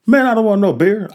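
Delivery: high, loud angry shout refusing the beer; angry refusal. Retold or imitated. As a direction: snap it back, furious. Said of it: "I keep going off".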